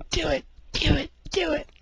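high, loud chant; cheering egging on. Retold or imitated. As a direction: egg him on like a crowd. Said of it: "No one ever tells me".

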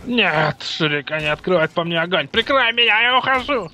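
shouting, pitch rising high; cover me shout. Pretend. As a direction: yell for cover, voice climbing. Mock war movie, under fire.

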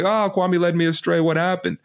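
high, hammed-up bewilderment; mock dismay. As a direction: play the baffled mediator. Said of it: "And then you say".

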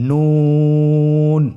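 নুন with the vowel held very long and thin; slow held recitation of নুন; long drawn vowel. Shown as a demonstration. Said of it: "তিন আলিফ টেনে পড়তে হবে".

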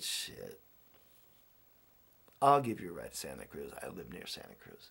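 a light imitation of a curmudgeon; gruff old man. The grumpy keeper grudgingly offers him a ride, gruff and low.

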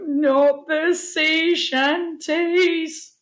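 high, very drawn-out wail; yelped dread. A narrator's reading. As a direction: wail it slowly in comic horror. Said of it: "yelped Mungo".

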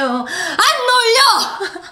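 high, drawn-out fan yell; roaring fan chant; imitated crowd cheer. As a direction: holler back like a concert crowd. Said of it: "그런 환호성".

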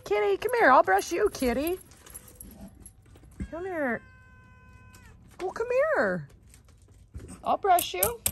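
high, sing-song coaxing of the animals; coaxing call. Real, not acted. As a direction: lure them over with a lifted pleading voice.

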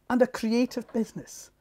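ordinary talk

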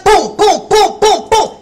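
loud, high booms; rapid volley of punched booms; imitated gunfire. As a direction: fire off booms like gunshots. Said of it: "Kinda like a gun".

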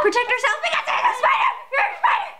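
high shrieking spider panic; screaming at a spider. Real, not acted. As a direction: shriek a warning, laughing. Spider attacks in game.

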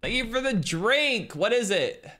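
high, bright gushing thanks; excited thanks. Real, not acted. Streamer thanking a viewer for boba, high and excited.

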